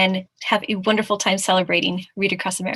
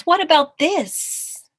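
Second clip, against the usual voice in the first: high, stretched storybook question; showing off; 8 semitones higher, 6 dB louder, much slower than usual. Acted. Mouse hanging by his tail, showing off in a high voice. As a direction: lift it high and draw it out.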